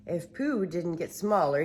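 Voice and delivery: ordinary delivery, nothing marked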